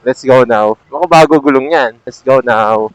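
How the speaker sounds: ordinary talk, nothing marked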